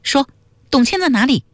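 high, curt demand at moderate volume; mildly forceful interrogation; loud interruption. A narrator's reading. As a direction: cut in with a high, insistent question. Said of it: "迟了了大声打断他说".

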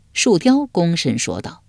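plain reading voice

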